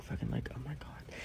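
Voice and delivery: breathy whispered relief; relieved whisper. Real, not acted. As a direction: whisper it like you finally escaped. Finally getting an Uber.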